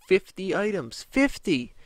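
indignant shout of the number; outraged disbelief. Real, not acted. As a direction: throw the number out in shock. Outraged at fifty items.

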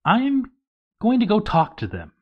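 high-pitched, eager character voice; dwarf's brave line. Acted. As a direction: pitch it way up, a plucky little dwarf. Dwarf Tremendous voiced in quote.

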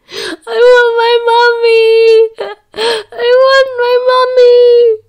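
high, loud, slow wailing through tears; sobbing child. A narrator's reading. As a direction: wail for his mother. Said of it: "crying now".

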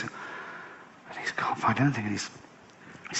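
grim storyteller whisper; hushed whisper. A narrator's reading. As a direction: drop to a whisper for the hard winter. Bleak winter, hushed storytelling.

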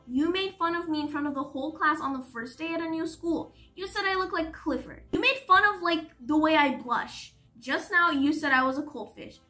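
indignant. A narrator's reading. Hurt boy listing her insults to her, indignant. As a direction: build the grievance, hitting key words hard. Boy lists his grievances.

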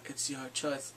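softly mocking listless voice; emo, comatose Bran. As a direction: imitate someone half-conscious and emo, quietly. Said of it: "All emo and comatose".

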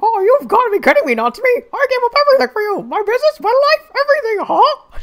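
very high, loud cartoon squawk; hammed-up wail of a dumped stalker; silly stalker voice. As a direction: squawk it like a jilted cartoon duck. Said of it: "I couldn't think of any other voice".

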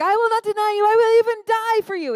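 loud high-pitched vow, shouted out; hammed-up loud boast of loyalty; mock boast. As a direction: belt it like a cocky promise. Peter's boast quoted in sermon.

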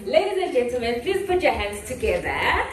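crowd-pumping introduction; announcer intro. Real, not acted. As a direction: sing out the speaker's name like a hype MC. MC announcing the speaker.

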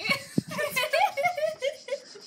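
high burst of laughter; cracking up at the joke. Real, not acted. Laughs at pun.

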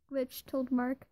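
ordinary delivery, nothing marked

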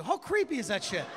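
ordinary talk

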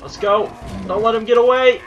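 high, loud hunting cries; excited shouting. Real, not acted. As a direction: urge the chase on at full voice. Chasing a rare deer.

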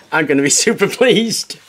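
amused, self-mocking laugh. Real, not acted. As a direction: laugh through the words at yourself. Jokes about own poor welding.

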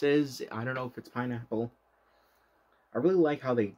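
speaking between chewy bites; talking while chewing. Real, not acted. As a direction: mouth partly full of candy. Seen 3: Bites gummy burger at start, jaw and cheeks show chewing while talking.

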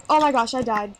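ordinary delivery

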